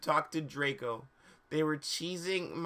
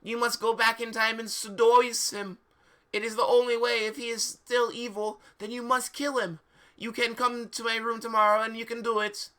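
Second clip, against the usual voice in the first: higher, louder hammed-up professor; cheesy oracle voice; mock seer voice; 7 semitones higher, 3 dB louder than usual. Professor voiced high throughout.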